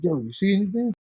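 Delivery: a hint of the friend's casual question; buddy's voice. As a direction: pitch up as the returning friend. Buddy returning in story.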